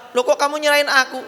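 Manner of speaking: high, indignant mock wife; startled wife. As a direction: act hurt and surprised. Wife shocked by husband's talak.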